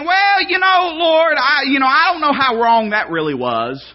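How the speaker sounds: high, loud whiny backpedaling; hammed-up squirming excuse to God; mock excuse-making. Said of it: "we start saying".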